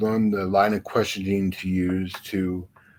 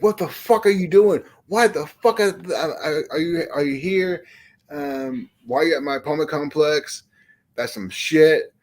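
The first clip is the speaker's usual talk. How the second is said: re-enacted angry yell, pitched higher; retold yelling; 6 semitones higher than usual. Man retelling how he confronted a predator, mock yelling. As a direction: imitate chewing him out, high and forceful. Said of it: "And I'm yelling at him".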